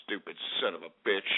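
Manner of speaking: very low, soft grumble; muttered curse. Real, not acted. Host cursing a listener to himself, muttered. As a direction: curse under the breath, pitch dropped right down.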